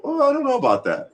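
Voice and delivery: high, mock-polite "oh I don't know"; polite doubt. Shown as a demonstration. Showing chat how to disagree, high mock-polite doubt. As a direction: raise a doubt sweetly instead of throwing shade. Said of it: "but I can also go".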